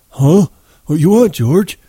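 high, loud simple-minded character voice; startled, high-pitched dim fellow; innocent simpleton. A narrator's reading. Lenny caught hiding the pup.

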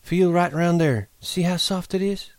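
put-on high, coaxing young woman; Curley's wife voice. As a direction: coax him to feel. Curly's wife voice.

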